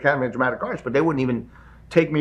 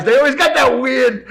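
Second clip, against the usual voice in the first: laughing; 7 semitones higher, 4 dB louder, slower than usual. Real, not acted. Laughing about wise guys.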